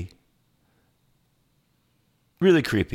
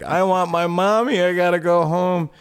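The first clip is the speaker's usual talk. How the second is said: put-on higher, louder, a scared little boy wanting home; mock crying kid; 8 semitones higher, 4 dB louder than usual. Retelling the scared nephew at movie night, mock tearful. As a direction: whine it like a frightened child. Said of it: "He's like".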